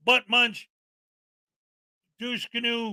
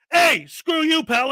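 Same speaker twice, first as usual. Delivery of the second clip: loud, higher hey screw you pal; mock angry snap; 6 semitones higher, 4 dB louder, slower than usual. Cop snapping back at a streamer, louder. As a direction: bark it like a riled-up cop. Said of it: "or would they be like".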